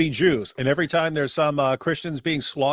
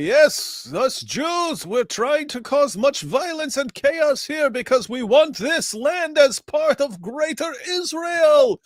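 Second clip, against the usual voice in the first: put-on high, slow Yiddish accent; hammed-up Yiddish accent, drawn out; 11 semitones higher, much slower than usual. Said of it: "we'll say".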